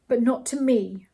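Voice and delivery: usual speaking voice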